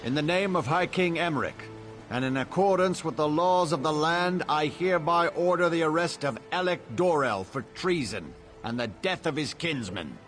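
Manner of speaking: high, ringing official decree; formal proclamation. Acted. Father orders his son's arrest, formal proclamation. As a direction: proclaim the arrest to all present.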